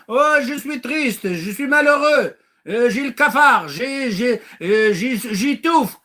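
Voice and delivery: hammed-up moaning; mock whining. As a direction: whine it like a self-pitying sulker. Mocking a whiny complainer.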